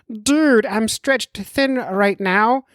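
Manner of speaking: high, drawn-out put-on doctor voice; slow, high-pitched caricature of a doctor friend; mock doctor voice. Podcaster voicing his doctor friend's message, mock doctor voice. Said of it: "I'll do the voice work for him".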